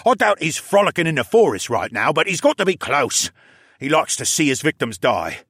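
high, loud captive voice; agitated captive Luca. Acted. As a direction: voice an uneasy prisoner giving up information.

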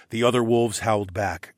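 plain reading voice

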